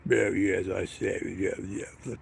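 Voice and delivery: a hint of a deep posh English drawl. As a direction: lightly sneer like a posh English toff. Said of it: "very talk posh have always got that deep voice".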